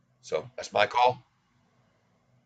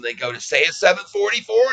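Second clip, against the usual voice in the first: ordinary delivery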